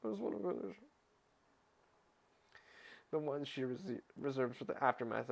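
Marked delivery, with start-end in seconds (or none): 0.0-0.8 whiny sigh. Real, not acted. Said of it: "let me just finish".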